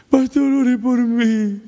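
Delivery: high, slow, tearful begging voice; weeping plea. Retold or imitated. Man crying to the pastor after service, tearful plea. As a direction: beg the pastor through mock tears. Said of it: "comienza a llorar".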